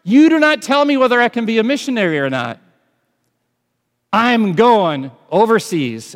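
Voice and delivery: high, loud defiant declaration; missionary defying his cancer, loud and high; defiant shout at cancer. Retold or imitated. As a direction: shout it down like you refuse to die. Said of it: "what he said to that cancer".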